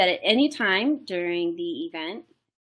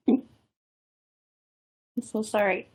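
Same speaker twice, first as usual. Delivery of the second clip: a hiccup breaks the words. Real, not acted. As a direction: caught by hiccups, apologizing. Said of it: "I have the hiccups".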